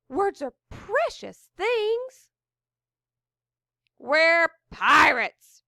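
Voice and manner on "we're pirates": slow loud pirate shout. A narrator's reading. Fiddleface shouts at the wizard, slow and loud. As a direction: bellow it like a proud pirate. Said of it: "shouted Fiddleface".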